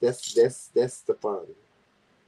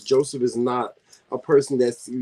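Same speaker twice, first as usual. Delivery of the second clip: ordinary talk, nothing marked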